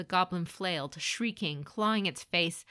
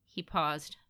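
ordinary delivery, nothing marked

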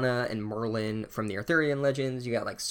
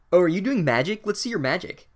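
put-on eager bossy girl; 7 semitones higher, 6 dB louder than usual. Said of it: "is like".

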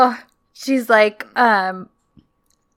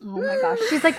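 ordinary talk, nothing marked

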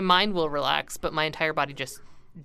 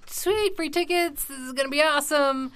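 excited; 10 semitones higher than usual. Retold or imitated. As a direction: gush about the free tickets. Retelling own excited thought.

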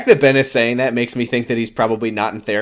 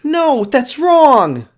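much higher, very slow anime protagonist objection; Makoto impression; 12 semitones higher, 4 dB louder, much slower than usual. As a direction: shout it like an anime courtroom rebuttal. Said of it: "That's Makoto from Danganronpa".

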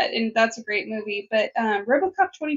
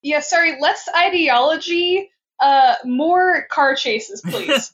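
mock studio exec; 5 semitones higher, 5 dB louder, slower than usual. Voicing a studio executive's demand.

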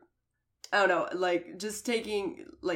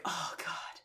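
breathy whispered oh god; whispered groan; 10 dB quieter than usual. Real, not acted. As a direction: whisper it in despair. Exasperated aside mid-story.

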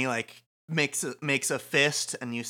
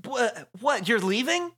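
high, stammered disbelief; shocked; 11 semitones higher, 3 dB louder than usual. Acted. Teammate watching Minamoto leave, shocked. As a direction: gasp out the questions high.

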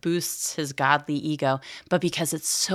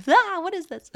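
very high, louder puzzled exclaim; mock bafflement; 5 dB louder than usual. Mom baffled by her son's craft, exclaiming high and loud. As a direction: act stumped by the craft, in high pitch.

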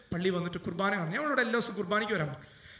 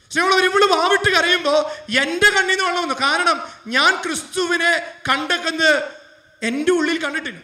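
impassioned preaching; 7 semitones higher, 10 dB louder than usual. Acted. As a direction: preach it with full force. Preaching the emotional peak.

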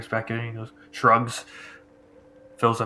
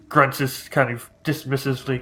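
ordinary talk

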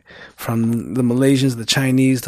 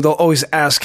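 ordinary talk, nothing marked

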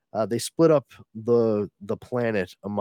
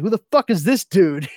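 higher and louder, baffled outburst; Wesley exasperated; 9 semitones higher, 7 dB louder than usual. Retold or imitated. Wesley confused by the stranger, exasperated outburst. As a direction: blurt it out, totally lost. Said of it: "Wesley's just like".

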